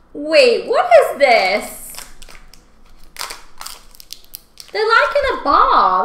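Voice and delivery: loud, high and slow surprise; startled and puzzled; surprised. Real, not acted. Opening a strange candy.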